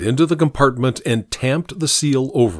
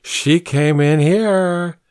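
put-on drunk man; 5 semitones higher, much slower than usual. Drunk insists to the cook.

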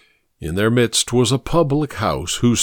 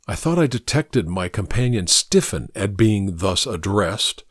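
plain reading voice

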